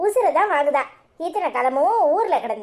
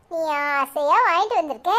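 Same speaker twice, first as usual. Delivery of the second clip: usual speaking voice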